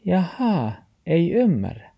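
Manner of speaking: high-pitched dog character; reply in a high little dog voice; talking dog. A narrator's reading. Dog takes in grandfather's explanation, high-voiced reply.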